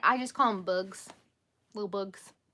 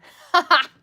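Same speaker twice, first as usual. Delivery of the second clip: loud, high laugh; real amused outburst, loud and high; burst of laughter; 9 semitones higher, 14 dB louder than usual. Real, not acted. Streamer amused by a nickname suggestion, laughing out loud.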